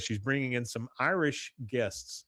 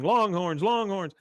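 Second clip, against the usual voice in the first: raised, higher mock crowd chant; crowd cheering; 8 semitones higher, 4 dB louder than usual. Imitating a stadium crowd cheering for its team, mock cheer. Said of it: "The crowd is cheering".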